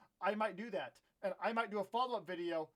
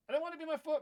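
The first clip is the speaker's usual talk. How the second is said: put-on high, whiny, childish whine of the line; whiny complaint; 9 semitones higher, much faster than usual. Kid whining to viewers that it's his foot, high and whiny. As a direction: whine it like a kid who won't accept it.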